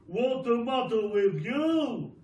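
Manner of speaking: loud put-on voice of a grumpy old husband; grumpy Mister Twit. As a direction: bark it out like a cranky husband. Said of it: "Mister Twit said".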